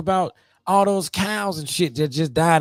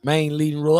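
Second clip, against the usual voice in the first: ordinary talk, nothing marked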